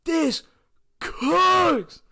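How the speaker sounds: high, loud thrilled yell; excited shout. Retold or imitated. Kid watching voice impressions, shouting with excitement. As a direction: cheer like it's the best thing ever. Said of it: "I was sitting there like".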